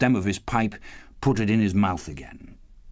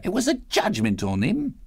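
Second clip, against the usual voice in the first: Mrs Dilber; 10 semitones higher than usual. A narrator's reading. Dilber condemning the dead.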